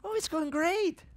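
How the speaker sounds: slow happy singsong answer; cheerful Tommy. Retold or imitated. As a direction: say it with innocent delight. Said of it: "He said".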